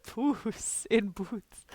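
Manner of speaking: stretched-out slow repeat; very slow drawl. Pretend. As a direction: drag every word out.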